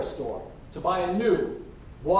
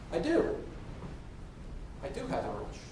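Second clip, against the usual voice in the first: sheepish realization; 4 semitones lower, 9 dB quieter than usual. Acted. Bob answers about the wrench, dropping to quiet realization.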